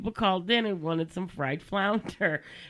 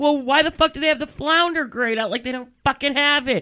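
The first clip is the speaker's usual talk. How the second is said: loud, high outraged protest; indignant outburst; 8 semitones higher, 9 dB louder than usual. Retold or imitated. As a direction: vent it in disbelief. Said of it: "I was like".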